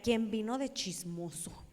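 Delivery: normal talking voice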